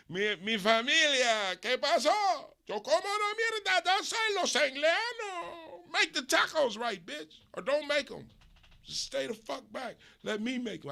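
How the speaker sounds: high, loud mock Mexican cook ranting in Spanglish; hammed-up outraged Mexican cook voice; angry taco cook. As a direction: rant like an offended abuela over bad tacos.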